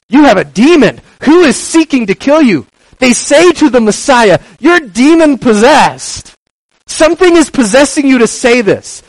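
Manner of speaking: mock accusing crowd. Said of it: "they respond".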